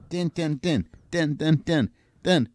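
imitated snare drum. Shown as a demonstration. Showing how much he uses snare drums, vocal drumbeat. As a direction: mimic a monotonous snare with your voice. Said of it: "how much he uses snare drums".

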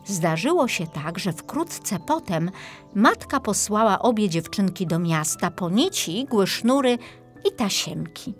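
ordinary delivery

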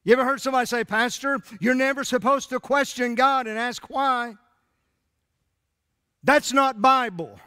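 loud, high, driving sermon; full-force preaching. Real, not acted. Preacher to congregation, building fiery preaching. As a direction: preach it out with fire.

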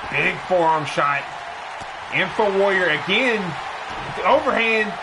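brisk ringside call, rising with the action; play-by-play commentary. Acted. Commentator calling the match for viewers, energetic play-by-play. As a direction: call the match like a wrestling announcer.

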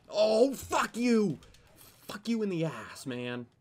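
slow, high, loud raging shout; drawn-out rage at the player who killed him; furious yell. Real, not acted. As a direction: scream it at the screen. Gamer killed, furious outburst.

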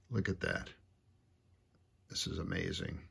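soft, marveling murmur over the gears; almost under the breath, in wonder; hushed awe. Real, not acted. Showing viewers the tiny gears, in hushed amazement.

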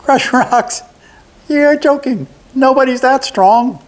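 high goblin voice scoffing with a laugh; mock the idea, high and amused; small goblin laughing off a threat. A narrator's reading. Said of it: "The goblin laughed".

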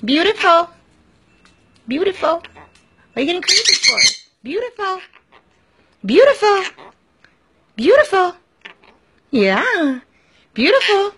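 high repeated cue word for mimicking; teaching the parrot. Shown as a demonstration. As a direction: say it up high and clear so the bird copies. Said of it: "teaching bird a word".

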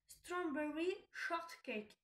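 slow, clumsy English accent on the brand name; bad English accent. Shown as a demonstration. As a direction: spell out the English name in a poor French-English accent. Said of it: "mon accent anglais est pourri".

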